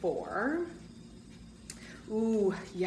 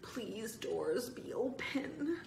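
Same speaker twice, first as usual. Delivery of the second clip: soft, halting plea rising at the end; hushed plea; 4 semitones higher, 7 dB quieter, much slower than usual. A narrator's reading. Boy pleading with the double doors to open, hushed halting plea. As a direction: beg under your breath, word by word.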